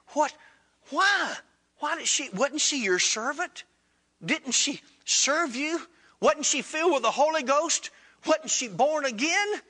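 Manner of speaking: high-pitched, halting string of questions; dismayed pleading. Retold or imitated. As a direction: plead the woman's case in acted disbelief. Disciple questions the Lord in dismay.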